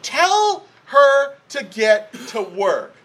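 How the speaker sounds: high, slow, emphatic order; mock demand. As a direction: demand it word by word, acting exasperated. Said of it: "she makes demands on him".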